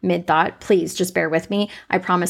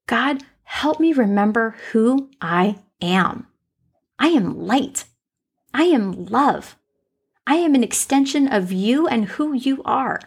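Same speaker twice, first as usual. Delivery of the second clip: forceful prayer; 3 semitones higher than usual. Real, not acted. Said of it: "drive the force in".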